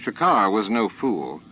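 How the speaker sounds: usual speaking voice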